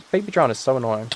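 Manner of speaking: ordinary delivery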